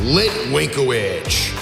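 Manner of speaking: normal talking voice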